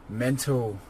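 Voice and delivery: ordinary delivery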